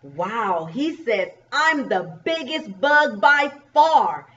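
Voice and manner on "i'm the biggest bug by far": slow, high, punched storybook boast; big drawn-out brag for children; boasting bug. Acted. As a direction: proclaim it as the champion bug. Reads biggest bug's boast.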